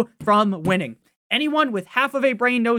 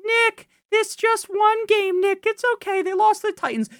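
imagined fan; 13 semitones higher than usual. Retold or imitated. Fan mocking the host's worry, high whiny voice. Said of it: "I know what you may be thinking".

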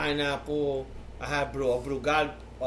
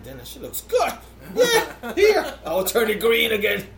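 high, loud comic giving-in; acted eagerness; 8 semitones higher, 6 dB louder than usual. Full dad giving in to more meat, hammed-up eagerness. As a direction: play up being tempted, pitched way up.

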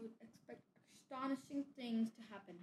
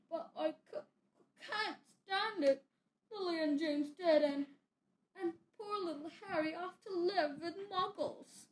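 crying, higher and louder; sobbing; 6 semitones higher, 6 dB louder than usual. A narrator's reading. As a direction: wail it through tears. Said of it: "sobbed Hagrid".

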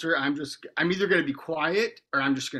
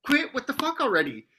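retold scolding; 9 semitones higher than usual. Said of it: "And I was like".

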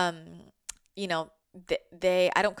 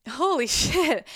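laughing through tears; 9 semitones higher, 7 dB louder, slower than usual. Real, not acted. Overwhelmed, laughs mid-phrase.

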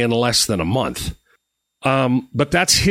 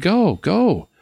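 higher, keen push to go; eager urging; 6 semitones higher than usual. Retold or imitated. Wife urging him to visit Don, eager and higher. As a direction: hurry him along with enthusiasm. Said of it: "And she said".

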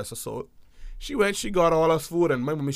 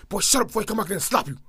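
quick, sharp mock mom threat, a bit higher; scolding mom; 6 semitones higher, faster than usual. Mom threatening the noisy kid in the back seat, quick mock scold. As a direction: play mom shutting the kid up, fast and stern.

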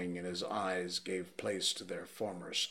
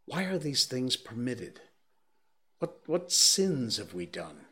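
raised, plaintive question; complaining tone; 5 semitones higher, 5 dB louder than usual. A narrator's reading. Curate complaining to the narrator, raised and plaintive. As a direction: whine it like a lost soul asking why. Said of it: "spoke in almost a complaining tone".

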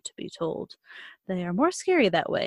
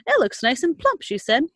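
mother voice; 6 semitones higher, 5 dB louder than usual. Acted.

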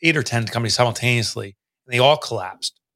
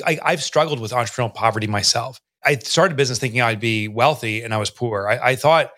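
ordinary talk, nothing marked

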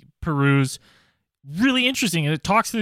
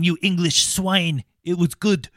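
French chef; slower than usual. Pretend. Mock French insult, slow.

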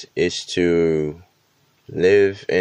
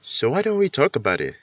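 ordinary talk

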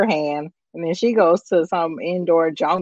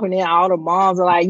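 normal talking voice

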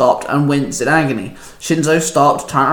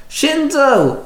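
much higher call of the name; 11 semitones higher than usual. A narrator's reading. Komori calling to Shinzo as he arrives, high call. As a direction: call out while flying down. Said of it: "he called out".

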